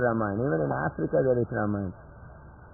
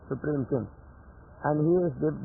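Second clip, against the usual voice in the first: ordinary talk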